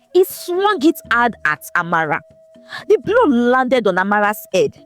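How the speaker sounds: usual speaking voice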